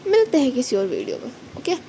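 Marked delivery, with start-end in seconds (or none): none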